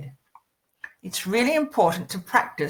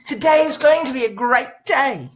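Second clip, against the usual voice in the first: louder, higher, emphatic exclamation; exclamation mark delivery; 5 semitones higher, 5 dB louder than usual. Shown as a demonstration. Voice coach showing the sentence's exclamation mark, emphatic exclamation. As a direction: declare it with a big exclamation mark. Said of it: "there's an exclamation mark at the end".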